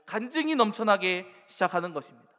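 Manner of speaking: full-force sermon prayer; fervent preaching. Acted. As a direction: pour out the prayer from the pulpit. Preaching climax.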